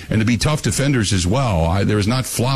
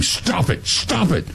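a higher, hushed mock scold to himself; self-scolding; 7 semitones higher, slower than usual. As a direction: tell yourself off, mildly and under your breath. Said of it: "I even catch myself doing it, saying".

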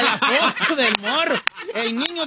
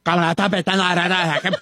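lower-pitched teasing impression of a co-host; mocking imitation; 5 semitones lower than usual. Host mimicking the gossip reporter's talk, mocking imitation. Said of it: "la gente va a pensar que sí hablo así".

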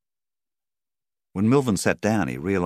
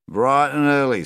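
put-on high, loud drunk's reply; 10 semitones higher, 8 dB louder than usual. A narrator's reading. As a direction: call it back as the pub drunk. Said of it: "said the drunk".

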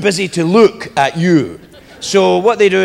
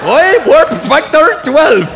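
put-on slow, loud, slurred Scottish accent; Scotty; 9 semitones higher, 6 dB louder, much slower than usual. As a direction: drag it out in a garbled, high Scottish accent. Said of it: "Scotty in Star Trek was the only one".

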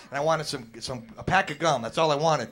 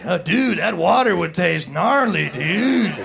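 loud stoner, dragging out the words; hammed-up bong-loving dude, slowing down; 5 dB louder, slower than usual. Stoner dude warning about bong water, hammed up.

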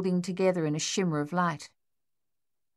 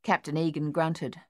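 ordinary delivery

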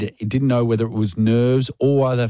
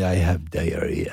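mock-seductive French accent, low and slow; slow, lowered French-lover accent; romantic French accent; 5 semitones lower, 4 dB quieter, slower than usual. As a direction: croon it low in pretend French. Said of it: "it's so romantic, the language".

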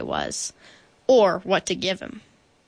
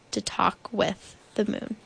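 plain reading voice

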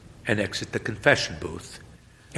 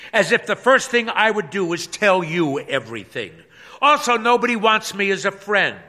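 high, loud, fed-up wife; pastor's wife voice; 8 semitones higher, 6 dB louder than usual. Acted. Pastor's wife venting to her husband, high and loud. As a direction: vent every grievance at full volume.

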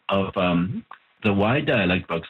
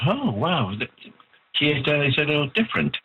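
pretend surprised reader; 7 semitones higher than usual. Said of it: "will realize".

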